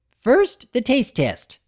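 high, eager, bouncy pony voice; bubbly Pinkie voice. Acted. Pinkie inviting Big Mac upstairs, high and bubbly. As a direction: chirp it up high like an excited party pony.

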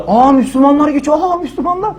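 high gasping "aa" of wonder; mock amazed onlookers. Bystanders spotting Muslims walking past, mock amazement. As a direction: gawp aloud like a startled bystander, hammed-up. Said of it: "bu moddaydık".

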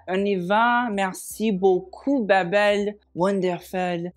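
put-on playful, drawn-out French accent; hammed-up French accent, English words included; mock French accent. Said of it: "I made that one up".

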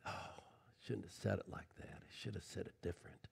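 very soft, low self-reproach; quiet regret. Acted. Preacher at home rethinking words, hushed regret. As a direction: murmur it to yourself, regretting.

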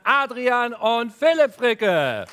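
loud, slow, high announcer voice; grand entrance announcement. Real, not acted. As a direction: announce them like a gala host. Showtreppe-Ankündigung der Gastgeber. (große Gala-Ankündigung)

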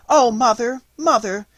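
put-on high, loud girlish cry. A narrator's reading. Marie interrupting her mother's account, high loud cry. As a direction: call out urgently to mother. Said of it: "cried Marie".

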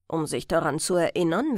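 plain reading voice

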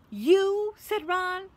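loud, high burst of disbelief; furious. A narrator's reading. Ron confronts Hermione in the common room, furious. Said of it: "He was furious".

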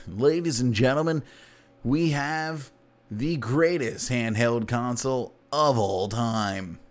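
slow, grand showman announcement; mock announcer. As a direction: unveil it like a stage presenter. Nokia launch announcement.

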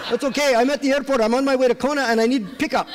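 put-on high, lilting Indian-accented voice; Indian accent caller. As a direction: play the pushy Indian caller asking for a ride. Same Indian caller continues.

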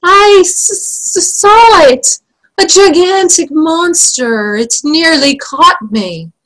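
stammering, high-pitched terror; King Fred moaning. Acted. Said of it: "moaned Fred".

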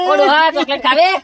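put-on high, loud offer to a crying old man; loud coaxing call. Relative offering crying old man a chocolate, loud coaxing shout.

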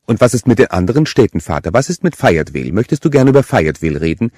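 mocking interrogation. A narrator's reading. Killer grilling the priest about Fayetteville, fast taunt. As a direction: press him, quick and relishing.

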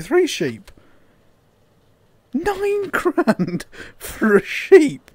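high, loud, squeaking disbelief with laughs; can't believe he killed sheep; shocked disbelief. Real, not acted. Gamer at his damage bill, shocked and squeaking high.

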